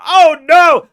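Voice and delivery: loud, high cry of alarm. Retold or imitated. Streamer showing how he reacts when Kerbals die, loud alarm. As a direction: yelp it as a rocket crew dies. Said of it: "I literally say".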